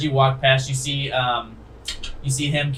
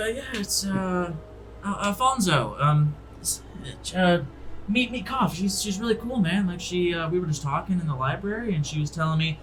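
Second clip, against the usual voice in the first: lightly voiced laid-back guy, a bit higher and softer; easygoing buddy making an introduction, softly; friendly NPC; 5 semitones higher, 5 dB quieter than usual.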